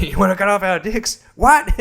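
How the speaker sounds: high-pitched incredulous comic bit; Dave Chappelle. Retold or imitated. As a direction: quote it high, leaping up on the last word. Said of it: "like Dave Chappelle said, where he's like".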